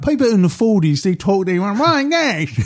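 mock British accent voice. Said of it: "He said".

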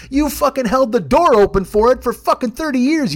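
ordinary talk, nothing marked

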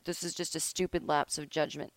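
ordinary talk, nothing marked

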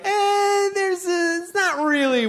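very high, stretched-out impressionist's whine; hammed-up comic impression voice; Dana Carvey. Shown as a demonstration. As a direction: start way up high, then slide down. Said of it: "like Dana Carvey impressions".

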